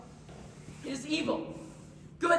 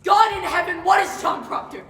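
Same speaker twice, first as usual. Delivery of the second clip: anguished shout; 6 semitones higher, 11 dB louder than usual. Acted. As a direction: howl it to heaven in torment. Proctor's anguished outburst before judges.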